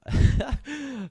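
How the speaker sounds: sudden laugh. Real, not acted. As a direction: burst of high loud laughter. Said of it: "sorry, I just read a comment".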